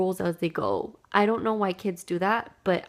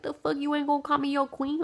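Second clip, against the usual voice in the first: sassy threat; 6 semitones higher than usual. Retold or imitated. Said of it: "I was like".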